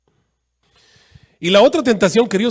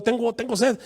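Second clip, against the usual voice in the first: mock thirsty cry; 7 semitones higher than usual. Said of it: "le estaban gritando".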